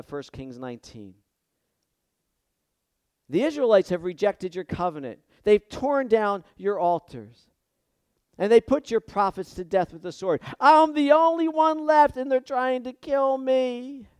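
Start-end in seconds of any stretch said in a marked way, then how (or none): 3.3-14.1 loud, desperate plea, climbing higher toward the end; rising anguished outcry, louder and higher; Elijah crying out. Retold or imitated. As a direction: cry out to God as a hunted prophet. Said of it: "he's crying out to God".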